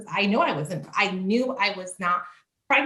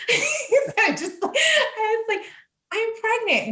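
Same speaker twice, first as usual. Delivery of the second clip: laughing, much higher; real laughter breaking in; 13 semitones higher, slower than usual. Real, not acted. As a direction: giggle through the words. Laughing while retelling.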